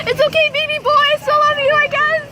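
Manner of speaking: high, loud, half-crying; dramatic lovesick fan; mock heartbroken fan. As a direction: forgive him with a wounded squeak. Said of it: "And I'm like".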